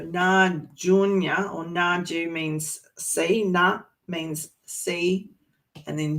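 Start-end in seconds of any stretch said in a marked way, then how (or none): 0.0-3.4 Na Junya said slowly and clearly; slowed-down teacher delivery; slow explanation. Shown as a demonstration. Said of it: "I'm gonna say it slowly". 3.4-5.3 Na said very slowly; slow teacher delivery; slow demo. Shown as a demonstration. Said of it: "I'm gonna say it slowly".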